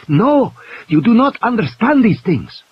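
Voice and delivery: high, loud stern refusal; superstitious man scolds the doctor; fierce insistence. A narrator's reading. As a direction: tell her off with conviction. He stares at her fiercely.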